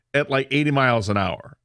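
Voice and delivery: ordinary talk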